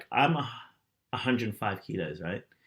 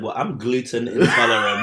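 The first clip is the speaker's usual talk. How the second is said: mock sassy comeback; 9 dB louder than usual. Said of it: "You said".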